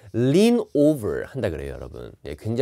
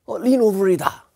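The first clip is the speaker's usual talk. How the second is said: mock hasty test-taker; 8 semitones higher, 5 dB louder, much slower than usual. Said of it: "바로 보고 뒤에를 듣지 않았을 때".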